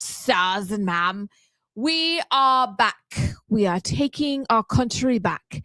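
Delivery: deliberate, mock-proper British delivery; British accent. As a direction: play an indignant Brit. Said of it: "You do a better British accent".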